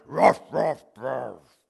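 repeated barks, the last one low and faded; dog barking "Roast". Acted. To the butcher, the dog barks its order, fading low.

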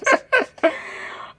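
high unvoiced burst of laughter; high squealing laugh. Real, not acted. Laughing at her joke.

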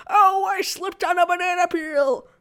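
loud, high put-on holler; trapped silly reading. Shown as a demonstration. Actor shows getting stuck in a line reading, loud silly voice. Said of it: "I might say it like".

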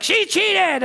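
put-on high-pitched squabbling kid; imitated child's yell. Son yelling back at sister, mock high yell.

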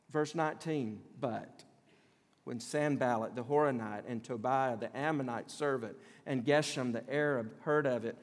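normal talking voice

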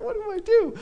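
high panicked squeak of a caught liar. Retold or imitated. Twenty-year-old musician panicking at customs, high squeak. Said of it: "And I'm like".

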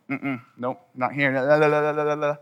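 put-on offhand, childish tuning-out; mock stubborn not-listening act; refusing to listen. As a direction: brush it off, then babble la-la-la over it. Acting out refusing to hear God.

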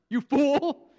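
loud, high-pitched rebuke; blasting away. Acted. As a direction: hurl it as a scathing insult. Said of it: "he's just blasting away".

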